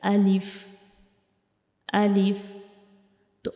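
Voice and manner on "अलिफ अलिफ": alif from the empty mouth cavity; alif pronunciation demo. Shown as a demonstration. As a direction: show how alif is pronounced, said alone. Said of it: "यहाँ से हम अलिफ को पढ़ते हैं".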